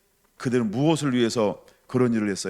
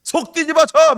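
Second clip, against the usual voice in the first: loud, high-pitched irate outburst; hammed-up furious yelling; put-on anger; 11 semitones higher, 8 dB louder than usual. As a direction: blow up theatrically at someone who bugs you. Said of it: "일부러 화를 막 내는 거예요".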